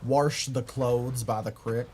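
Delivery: slow Baltimore accent, "Wash" as warsh and "crick" for creek. Shown as a demonstration. Streamer demonstrating Baltimore talk, slow local accent. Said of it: "they say that in Baltimore too".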